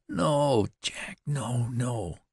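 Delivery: high, pleading cry of no. A narrator's reading. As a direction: beg him not to go, voice rising high. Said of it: "cried Betty".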